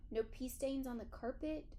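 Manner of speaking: ordinary delivery, nothing marked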